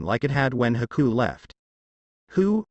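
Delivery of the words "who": high-pitched puzzled question; a confused, higher-pitched query. A narrator's reading. Zabuza reacts to news of someone dying, confused. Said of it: "Zabuza asked in confusion".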